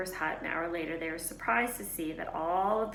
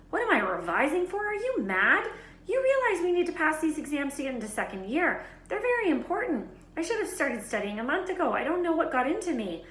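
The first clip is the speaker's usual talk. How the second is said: much higher, louder frantic outrage; indignant girl; 10 semitones higher, 6 dB louder than usual. A narrator's reading. Hermione ranting to Ron about exams. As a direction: panic about falling behind.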